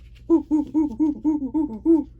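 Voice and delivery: loud high hooting laugh; a happy gorilla hoot. Acted. Reading the flannel board, gorilla calling out, loud hoot.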